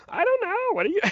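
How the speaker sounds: high put-on cartoon voice; neighbor's Kermit voice. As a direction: do the neighbor's odd voice. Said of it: "He was like".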